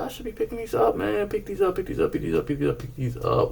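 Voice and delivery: goofy high voice. Acted. High goofy chant to viewers.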